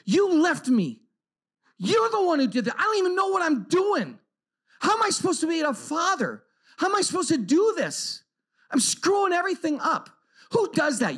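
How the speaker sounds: high-pitched, exasperated blaming; re-enacting a furious inner rant; retold angry anguish. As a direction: plead and accuse, voice pitched high. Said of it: "I'm so angry, I start thinking to myself".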